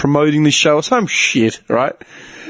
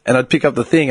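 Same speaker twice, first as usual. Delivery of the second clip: ordinary delivery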